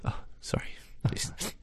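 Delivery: whispered, pretending to be choked up; hushed fake sob-story voice; mock teary whisper. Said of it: "making me cry".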